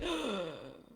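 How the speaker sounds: a playful staged gasp of longing; mock gasp. Wife reacting to husband's tease, mock longing gasp. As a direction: gasp as if he's left too soon. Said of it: "And she's like".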